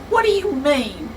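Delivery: indignant, loud and clipped; offended secretary, loud and livid. Acted. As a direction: snap back in offended fury. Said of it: "Miss Sneed is livid".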